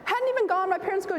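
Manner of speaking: ordinary talk, nothing marked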